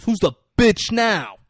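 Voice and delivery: high, loud, drawn-out gloat; triumphant taunt. Retold or imitated. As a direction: crow it slowly in triumph. Acted gloat after shooting the boss.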